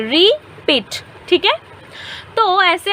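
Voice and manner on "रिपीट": रिपीट said slowly as ri-peet, syllable by syllable; broken into syllables. Shown as a demonstration. Teacher showing students how रिपीट breaks into syllables, slow and split. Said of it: "अगर रिपीट बोलेंगे तो उसको हम तोड़ सकते हैं".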